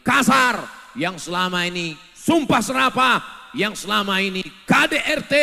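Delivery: loud, rising preacher's cry; shouting. Real, not acted. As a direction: hammer each item out. Preacher listing abusive husbands. (seruan keras penceramah)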